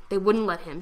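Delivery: usual speaking voice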